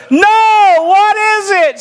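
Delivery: put-on high, drawn-out holler of a teenage girl; calling back. The other girl answers her friend in McDonald's, high holler.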